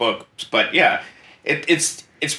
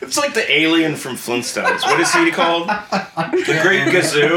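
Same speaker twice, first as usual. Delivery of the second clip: high-pitched laughing through the words; 6 semitones higher than usual. Real, not acted. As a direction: crack up mid-sentence. Cracking up at the word.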